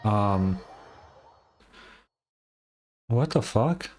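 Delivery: ordinary talk, nothing marked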